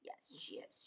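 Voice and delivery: unvoiced hush; whisper. Real, not acted. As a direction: whisper so she sleeps. Whisper about sleepy dog.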